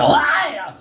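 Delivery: high startled yelp. Acted. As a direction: yelp like slipping on ice. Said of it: "every time I slip, I get to go".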